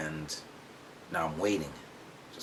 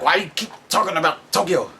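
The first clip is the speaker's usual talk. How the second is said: quick, higher, mildly annoyed dad; dad questioning mom in a slightly higher voice; dad's voice; 8 semitones higher, faster than usual. Retold or imitated. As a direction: fire it off like a suspicious dad.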